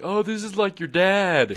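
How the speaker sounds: high, slow teasing voice; mock classmates. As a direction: lightly mimic the kids in class. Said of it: "everybody was like".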